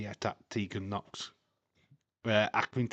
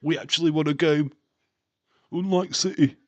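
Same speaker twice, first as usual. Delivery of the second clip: mock shout; 5 semitones higher, 9 dB louder than usual. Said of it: "Dave, like".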